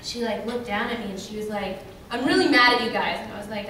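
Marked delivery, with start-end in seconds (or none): 2.1-3.2 louder, firm imitation of a complaint; mock angry. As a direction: confront them, mildly cross and loud. Imitates angry fan confronting her.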